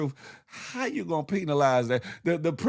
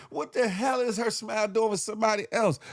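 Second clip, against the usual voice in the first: ordinary delivery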